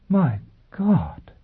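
stunned, high exclamation; exclaiming. Acted. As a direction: gasp it out in shock. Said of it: "Gisbella exclaimed".

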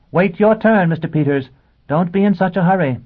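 high, loud teacherly call; addressing the class. Acted. Teacher telling a pupil to wait, loud and high. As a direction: project a brisk reminder to the back row.

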